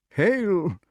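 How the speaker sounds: high echo of a greeting; high-pitched kingly salutation; dotty king. Acted. Pellinore echoes Merlin's greeting.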